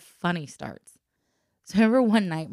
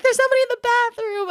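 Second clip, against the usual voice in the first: high, loud, fast frightened blurt; panicked report; 9 dB louder, faster than usual. Retold or imitated. Girls telling their mom about an intruder, high and panicked. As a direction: rush it out like scared kids.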